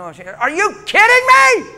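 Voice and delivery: high, loud, slow cry of disbelief; fervent shouted disbelief; incredulous shout. Real, not acted. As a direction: hurl the question at the crowd. Preacher's climax cry.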